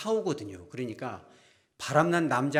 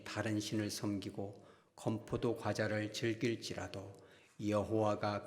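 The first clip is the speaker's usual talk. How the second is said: slow, low, measured Bible reading; scripture reading; 4 semitones lower, 7 dB quieter than usual. Real, not acted. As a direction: read the verse solemnly and softly, unhurried. Reads scripture aloud with congregation. (낮고 느린 성경 봉독)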